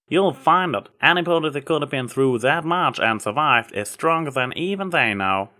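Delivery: a hint of a country farm mare, kindly; lightly voiced Applejack. A narrator's reading. Applejack brushing off Trixie's apology, lightly voiced country mare.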